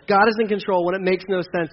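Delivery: normal talking voice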